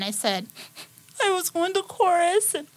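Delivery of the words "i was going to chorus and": high, tearful voice, faster and louder at the end; crying. Retold or imitated. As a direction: blurt it out while sobbing. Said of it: "like, crying".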